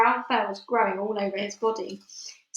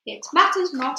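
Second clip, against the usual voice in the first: high, loud commanding witch; shouting witch; 6 semitones higher, 5 dB louder than usual. A narrator's reading. Witch shouts to the crowd that it matters not. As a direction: dismiss it with a shout. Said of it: "shouted the Grand High Witch".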